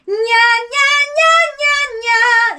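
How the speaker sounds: high, loud, thick belted nya; belting. Shown as a demonstration. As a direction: push it out full-chested and tight. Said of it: "go into a belting sound, which would be".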